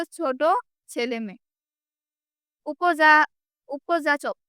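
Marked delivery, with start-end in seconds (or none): none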